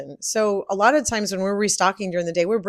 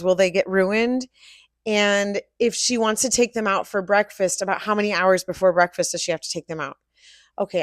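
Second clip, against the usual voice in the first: usual speaking voice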